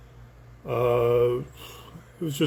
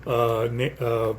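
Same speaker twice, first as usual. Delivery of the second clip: ordinary talk, nothing marked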